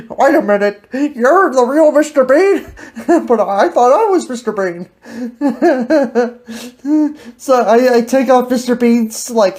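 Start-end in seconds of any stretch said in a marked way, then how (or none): none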